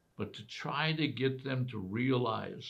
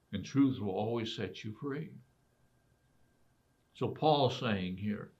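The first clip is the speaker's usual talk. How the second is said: usual speaking voice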